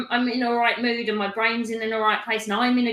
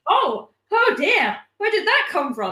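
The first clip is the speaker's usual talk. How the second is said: mock surprise; 7 semitones higher than usual. Acting surprise at her own thoughts.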